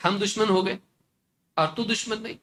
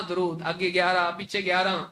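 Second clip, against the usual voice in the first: ordinary talk, nothing marked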